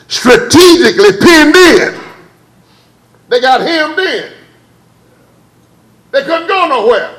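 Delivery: high, loud preaching cadence; preacher building fire; rising preaching. Acted. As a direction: lift the congregation with it. Preaching at full force.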